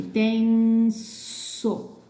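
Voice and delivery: tenso drawn out, crisp hissed S; true S sound. Shown as a demonstration. Teacher giving a last example, slow tenso with true S. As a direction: stretch "ten", then a clear voiceless "so" in tenso. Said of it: "S com som de S mesmo".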